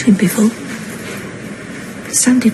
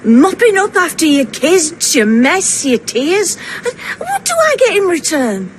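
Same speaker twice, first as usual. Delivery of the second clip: tearful self-pity; 6 semitones higher than usual. Acted. Mother complains to her grown kids about her sacrifices, tearful and self-pitying. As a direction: voice cracking into a mock sob on the complaint.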